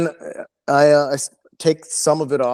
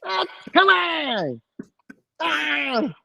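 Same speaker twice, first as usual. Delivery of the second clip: very high, stretched-out mock wail; long squealed whine trailing into a cry; drunken protest; 3 dB quieter, much slower than usual. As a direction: imitate a drunk howling in protest. Drunk friend's protest, repeated higher.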